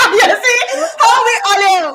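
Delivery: high, loud laughing shout; shrieking laughter. Real, not acted. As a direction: howl with laughter. Hysterical laughter at arrivals.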